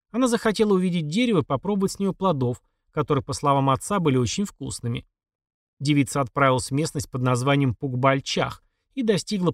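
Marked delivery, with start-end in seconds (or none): none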